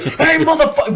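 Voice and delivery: high, loud drill-sergeant holler; imitated sergeant yell. Sergeant ambushing a young marine, mock yell.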